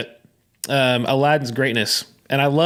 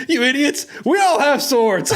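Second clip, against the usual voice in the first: exasperated cop; 10 semitones higher than usual. Retold or imitated. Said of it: "one of the cops is like".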